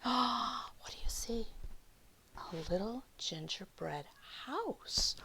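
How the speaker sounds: breathless unvoiced whisper; gasp, then ask in a whisper; awed whisper. A narrator's reading. Asking the children, a gasping whisper. Reader asks kids in awe.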